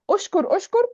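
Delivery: أشكر said twice, pitched high; emphatic repetition. Shown as a demonstration. Teacher shows the same word repeating, a high emphatic أشكر أشكر. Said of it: "the same word repeats".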